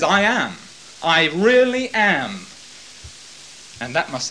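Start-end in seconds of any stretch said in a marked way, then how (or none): 0.0-2.5 slow, loud stress on "really"; emphatic I am. Acted. Jesus affirming to Caiaphas, loud with "really" stressed. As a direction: lean on "really" with full conviction. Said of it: "He actually says".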